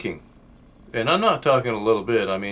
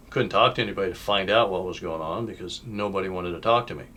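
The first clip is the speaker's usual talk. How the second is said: ordinary talk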